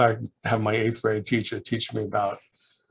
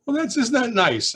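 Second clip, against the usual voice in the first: mother's delight; 12 semitones higher than usual. Retold or imitated. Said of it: "I said".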